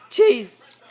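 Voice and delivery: loud call of the dog's name. Real, not acted. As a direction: call him sharply across the room. Calling the dog.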